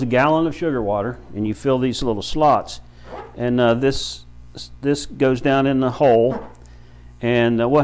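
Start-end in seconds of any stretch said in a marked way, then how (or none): none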